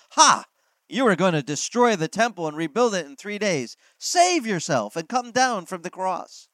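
a loud, high "Ha!" then sneering taunts; mocking jeer. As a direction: jeer at him from below the cross. Passers-by mocking Jesus.

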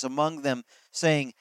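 ordinary talk

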